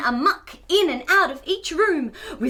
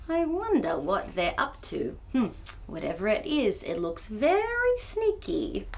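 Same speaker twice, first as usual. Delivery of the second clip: low, soft sneaky murmur; soft suspicious aside; conspiratorial hush; 7 semitones lower, 6 dB quieter than usual. Acted. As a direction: lean in and whisper suspiciously. Sneaky twins described.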